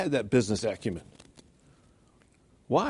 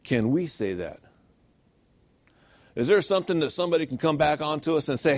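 ordinary delivery

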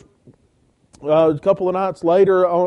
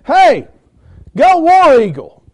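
high, loud call across a parking lot; 9 semitones higher, 9 dB louder, slower than usual. Retold or imitated. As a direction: holler the team cheer, slowing on go War Eagle. Fan calling across parking lot.

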